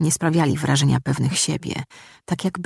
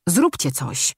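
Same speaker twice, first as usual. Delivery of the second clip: plain reading voice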